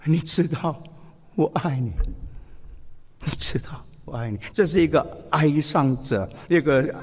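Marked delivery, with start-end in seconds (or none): 0.0-4.4 fading much softer to the end; tearful Peter, voice dying away; speaking through tears. Retold or imitated. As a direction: trail off as if weeping. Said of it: "一面讲一面哭".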